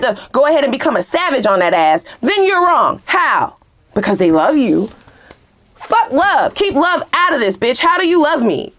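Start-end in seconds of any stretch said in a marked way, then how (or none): 5.7-8.7 fired-up higher outburst at the liar; mad outburst. Real, not acted. Venting at a lying lover, indignant rant. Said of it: "it's getting me mad".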